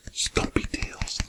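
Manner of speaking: breathy hushed whisper of the words; soft storybook whisper. Acted. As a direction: lean in and whisper it like a secret. Whispered line while reading picture book.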